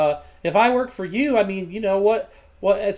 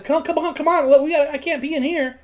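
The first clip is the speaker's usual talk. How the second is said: higher-pitched, hurried embarrassed pleading; flustered urging; 8 semitones higher, faster than usual. Acted. Boy drags customer out of dad's store, flustered. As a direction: hustle them out, voice raised in pitch.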